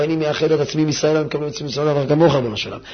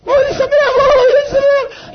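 very high, loud, crying-tinged cry of joy; wailing joy; 6 dB louder than usual. Shown as a demonstration. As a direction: shout the joy out like a wail. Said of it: "לא, זה שמח".

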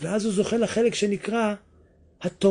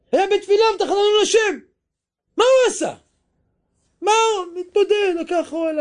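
much higher and louder fervent preaching; preaching at full force; 13 semitones higher, 4 dB louder than usual. Acted. Preacher tells listeners of Moses praying, fervent and high. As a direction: cry it out with fervour.

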